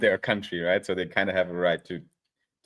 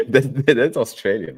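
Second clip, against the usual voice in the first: laughing; 3 semitones higher, 7 dB louder than usual. Real, not acted. Laughing at the accent attempt.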